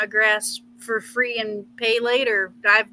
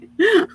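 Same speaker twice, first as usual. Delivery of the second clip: short laugh; 11 semitones higher, 7 dB louder than usual. Real, not acted. Embarrassed laugh mid-greeting.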